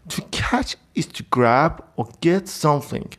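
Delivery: slow dictation voice; slow definition read. Shown as a demonstration. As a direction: read it out slowly for students. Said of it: "حالا ببینیم دفینیشن".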